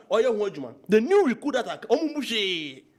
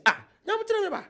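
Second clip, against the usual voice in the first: exasperated outcry; 14 semitones higher than usual. Real, not acted. Exasperated cry to God.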